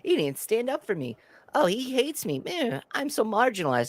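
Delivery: high-pitched, put-out complaint; mock pissed-off whining. Mimicking her griping that he didn't stand up for her, mock whine. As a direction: whine it, mocking her grievance. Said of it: "she was pissed later on".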